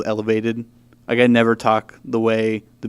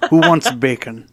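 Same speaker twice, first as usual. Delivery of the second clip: a bad, louder New York butcher accent, laughing through it; terrible Bill the Butcher accent; 2 semitones higher, 6 dB louder than usual. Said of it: "That's a terrible, um, Bill the Butcher accent".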